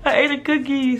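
high-pitched whiny admission; mock distraught confession. Kid confessing to mother, high mock-distraught whine.

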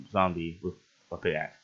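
ordinary talk

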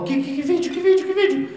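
high, rising panicked mom voice; mother's alarmed questions. Retold or imitated. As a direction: squeak out the questions like a flustered mother. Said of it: "Ela falou".

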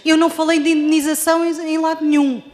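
ordinary talk

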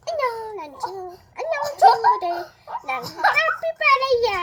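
high, loud squeaky puppet greeting; bright squeaky sing-song welcome. Pretend. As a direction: greet the kids as a squeaky capybara.

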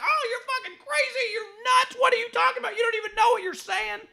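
high, shouty mock outrage; mocking outraged people. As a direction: sneer back at the critics in a shrill high voice. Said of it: "people are like".

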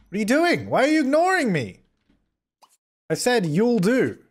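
high, indignant whine; wailing at being ignored; exasperated protest. Real, not acted. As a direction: demand attention in a high voice.

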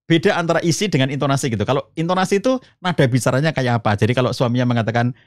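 ordinary talk

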